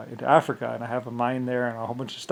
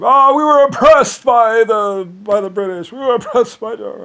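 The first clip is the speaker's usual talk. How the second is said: mocking whine; 10 semitones higher, 13 dB louder than usual. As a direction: sneer at their claim in a whiny voice. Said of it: "they claim".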